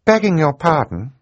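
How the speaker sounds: put-on high, eager young girl's interruption; sudden interjection. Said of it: "Violet said suddenly".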